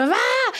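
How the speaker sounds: high mock bawl; imitated crying. Next child dropped off from the car, imitated bawling. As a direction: another kid's wail, played up. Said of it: "eran niños llorando así mal".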